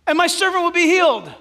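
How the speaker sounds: high, loud cry of faith; acted centurion's shouted plea; fervent plea. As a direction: call it out boldly and urgently.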